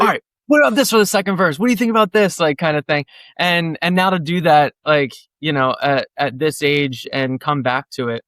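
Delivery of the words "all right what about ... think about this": higher, fast and eager pitch; eager young songwriter. Retold or imitated. Nineteen-year-old pitching a verse to his bandmate, fast and eager. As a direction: rattle off ideas like a keen nineteen-year-old. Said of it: "and just like".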